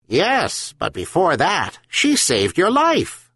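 high, wise gull voice; herring gull. A narrator's reading. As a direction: speak up high like a patient seabird. Gull answers old man.

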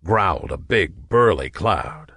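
ordinary delivery

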